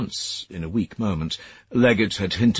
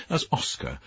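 ordinary delivery, nothing marked

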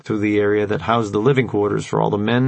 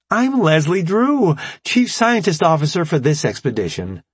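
put-on perky young scientist; 7 semitones higher than usual. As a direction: sweetly introduce yourself. Perky scientist introduces herself.

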